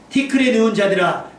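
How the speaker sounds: steady, declamatory verse reading; scripture reading. Shown as a demonstration. Preacher reading Isaiah to the congregation, declamatory reading. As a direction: read it out firmly at an even pace.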